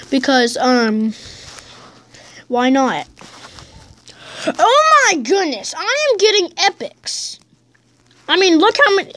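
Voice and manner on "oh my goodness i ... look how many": high, delighted squeal over the epics; thrilled at the loot, pitched way up; excited. Real, not acted. Kid opening chests, excited high voice.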